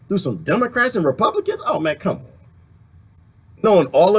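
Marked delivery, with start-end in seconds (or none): none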